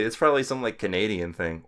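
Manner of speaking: ordinary talk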